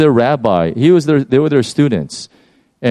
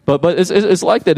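usual speaking voice

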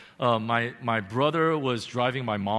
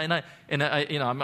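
normal talking voice

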